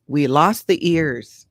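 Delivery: ordinary delivery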